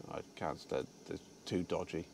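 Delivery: ordinary delivery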